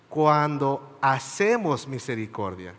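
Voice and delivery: slow, with hacemos pressed hard; stress on hacemos. Shown as a demonstration. Preacher to brothers, underlining hacemos slowly. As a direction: lean on the word hacemos and take it slowly. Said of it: "Y yo enfatizo la palabra hacemos".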